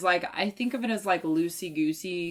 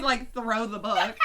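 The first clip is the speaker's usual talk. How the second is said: ordinary talk, nothing marked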